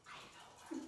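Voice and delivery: whispered, barely audible aside; under the breath, almost voiceless; hushed aside. Real, not acted. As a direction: drop it to a private whisper. Hushed aside after a pause.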